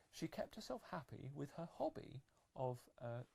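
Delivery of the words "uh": ordinary delivery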